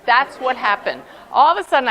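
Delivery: usual speaking voice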